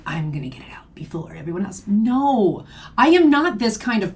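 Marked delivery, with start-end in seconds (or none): 0.1-1.8 mock scheming whisper, quick and hushed; conspiratorial whisper. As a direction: whisper it fast like a sneaky plotter. Mimicking a supposed secret scheming rival.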